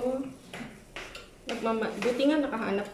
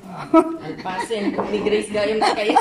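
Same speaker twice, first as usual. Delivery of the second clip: laughing; 3 semitones lower, 7 dB louder, much slower than usual. Real, not acted. Laughing joking remark.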